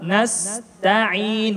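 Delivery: "nasta'in" slowly, light t and fronted a; thin letters. Shown as a demonstration. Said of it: "semuanya tipis". (nasta'in pelan dan tipis)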